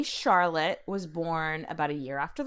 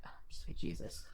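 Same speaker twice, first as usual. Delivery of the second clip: breathy whispered dread, barely voiced; dismayed whisper; 3 semitones lower, 13 dB quieter than usual. New wife dreading her husband, whispered dismay. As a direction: mutter it under your breath in horror. Wife's dismay on the wedding night.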